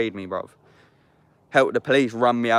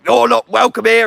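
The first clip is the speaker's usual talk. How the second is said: high, loud mock of the mum slamming him off; shrill outraged doorstep shout; hostile mum; 12 semitones higher, 11 dB louder than usual. His friend's mum turning him away at the door, shrill and loud.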